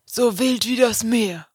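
imitated Jever advert, unhurried; beer advert slogan. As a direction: draw it out like an ad tagline. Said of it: "bei Jever mit dem".

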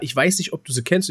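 usual speaking voice

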